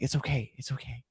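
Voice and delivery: soft unvoiced whisper, a mock soothing hush; whispered comfort. Alien whispering to calm Betty, softly. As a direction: hush her like a kind captor. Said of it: "being like".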